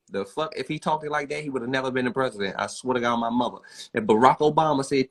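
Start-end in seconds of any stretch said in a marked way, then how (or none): none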